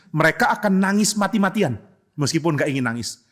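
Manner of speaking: usual speaking voice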